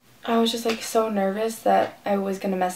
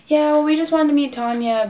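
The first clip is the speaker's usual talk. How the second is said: higher, louder re-enacted reply; retold eager answer; 7 semitones higher, 5 dB louder than usual. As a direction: act out eagerly explaining to a guard. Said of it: "We're like".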